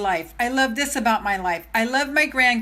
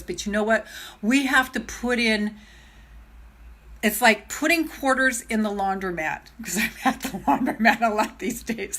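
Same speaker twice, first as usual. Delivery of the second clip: ordinary delivery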